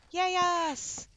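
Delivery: high, drawn-out little hooray; happy cheer. Real, not acted. Player cheering to viewers on returning to the game, happy cheer. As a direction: cheer for your own team.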